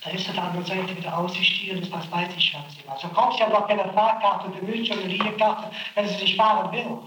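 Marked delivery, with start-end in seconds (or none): none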